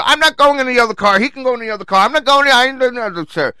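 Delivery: high, loud whiny refusal; mock moaning.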